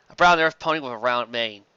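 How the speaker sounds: ordinary delivery, nothing marked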